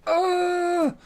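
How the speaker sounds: sudden high-pitched yelp; sharp annoyed shriek; frustrated cry. Real, not acted. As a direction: cry out in exasperation. Spots foreign domain, frustrated.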